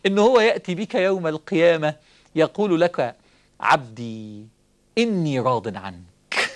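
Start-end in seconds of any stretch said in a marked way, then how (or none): 3.6-6.5 lower, slow, tender assurance; voicing God, very low and slow. Preacher voicing God telling the servant He is pleased, very low and slow. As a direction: speak it slowly like divine reassurance.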